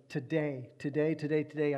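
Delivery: each "Today" pressed with weight, again and again; stressing today. Real, not acted. Preacher to congregation, hammering "Today" with urgency. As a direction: lean on "Today" every time as if it can't wait. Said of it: "I keep stressing that".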